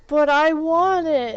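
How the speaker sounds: high and loud, a mock pouty demand; mocking childish demand. The other woman demanding the blender, mock pouty. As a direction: whine it like a spoiled kid.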